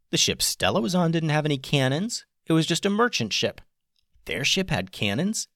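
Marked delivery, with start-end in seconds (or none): none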